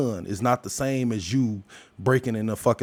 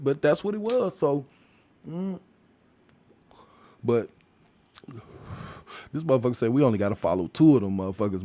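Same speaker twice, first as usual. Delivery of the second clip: ordinary delivery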